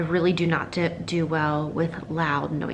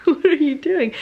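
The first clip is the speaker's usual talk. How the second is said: higher and louder, laughing through it; amused call to whoever interrupts; laughing question; 10 semitones higher, 6 dB louder than usual. Real, not acted. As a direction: laugh as you ask what they're up to. Laughing at someone mid-recording.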